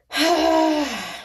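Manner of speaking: high, falling sigh; heavy sigh. Real, not acted. Reader collecting herself after wailing, sighing.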